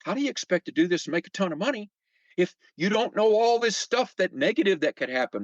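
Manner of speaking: fast, rising incredulous challenge. Retold or imitated. Challenging his friend Rick about the risks, fast and incredulous. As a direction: press him hard on the risks. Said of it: "and I'm going".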